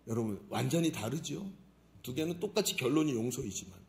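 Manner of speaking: ordinary delivery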